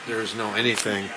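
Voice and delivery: usual speaking voice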